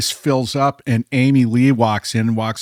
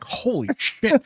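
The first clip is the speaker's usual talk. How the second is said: high, softer exclamation; retold stunned reaction; awed disbelief; 7 semitones higher, 5 dB quieter, slower than usual. Learning his friend plays in a famous band, awed.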